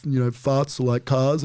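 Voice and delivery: mock a struggling comedian pitching a bad bit; doing a whiny comic who needs help. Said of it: "They're like".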